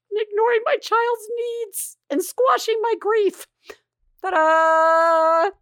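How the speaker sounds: high singsong ending in loud, held fanfare; mock-triumphant singsong. Storyteller announcing her parenting fail, mock-triumphant singsong. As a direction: present your failure like a showy finale.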